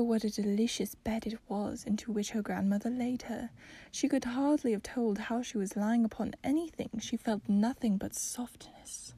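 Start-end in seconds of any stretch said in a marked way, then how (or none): none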